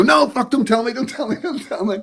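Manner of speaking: high, frantic no-spoilers plea; panicked plea. Retold or imitated. Friends begging not to know.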